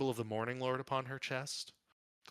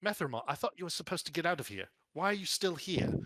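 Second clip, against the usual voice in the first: higher, faster, startled demand; surprised cleric; 7 semitones higher, 3 dB louder than usual. Acted. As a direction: catch him off guard, why is he here. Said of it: "Tamara addressing Methramar".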